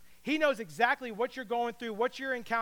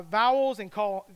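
usual speaking voice

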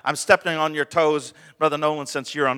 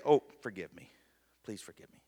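softer, pleading forgive me; quiet heartfelt plea; sincere plea; 6 semitones lower, 9 dB quieter, faster than usual. Shown as a demonstration. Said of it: "means something completely different than sorry".

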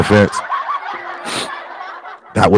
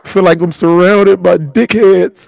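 high-pitched tearful wail breaking into laughter; real sobbing, voice shot way up; crying; 10 semitones higher than usual. Real, not acted. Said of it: "I'm crying like a bitch".

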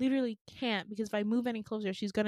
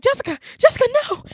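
high, very loud panicked call; desperate scream; 14 semitones higher, 14 dB louder, much faster than usual. Retold or imitated. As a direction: cry out to someone falling, acted out. Retold calling to falling woman.

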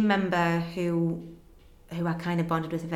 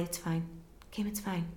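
softer, calm and soothing; really calm; 10 dB quieter than usual. Retold or imitated. Mum on the phone calming her upset daughter, softly. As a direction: settle her down gently. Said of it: "really calmly".

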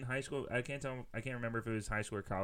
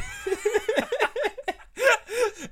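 loud high laugh; 9 dB louder than usual. Real, not acted. Cracking up over the beige plague joke, loud high laugh.

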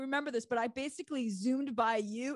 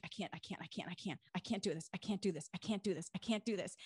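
quiet, panicked muttering; nervous quiet repeating; frozen with fear; 2 semitones lower, 6 dB quieter, faster than usual. Retold or imitated. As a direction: whisper it like you're frozen at the cliff edge. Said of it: "I was so nervous and I just was frozen".

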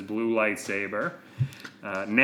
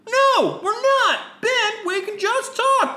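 very high, loud plea; peacemaking Jedi; 5 dB louder, slower than usual. Acted. Jedi tries to talk Ben down, high urgent plea.

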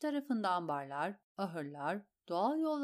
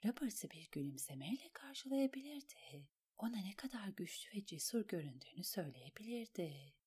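whispered inner monologue; inner thought; 7 dB quieter than usual. A narrator's reading. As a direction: think it in a hushed whisper. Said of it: "diye düşündü Alain".

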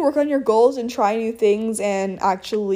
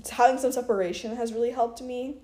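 normal talking voice

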